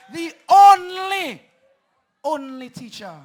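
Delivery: roaring campaign hype; rally shout. Real, not acted. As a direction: stretch and shout it to the crowd. Hyping the candidate at rally.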